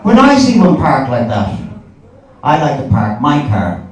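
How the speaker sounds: ordinary talk, nothing marked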